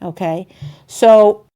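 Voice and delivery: ordinary talk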